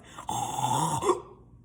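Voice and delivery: a sudden gasp of relief; sharp gasping cry. Retold or imitated. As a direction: gasp as the noise cuts off. Relief when noise stops.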